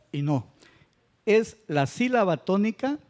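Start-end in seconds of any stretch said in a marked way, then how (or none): none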